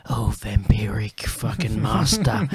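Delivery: slow, whispery Cockney stage voice; hammed-up Cockney goth whisper, drawn out. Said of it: "he's all just like".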